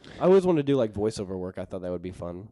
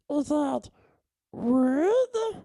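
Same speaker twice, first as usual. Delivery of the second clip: very high, squeaky cartoon critter; Mister Woodchuck voice; 7 dB louder, much slower than usual. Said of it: "Mister Woodchuck impression".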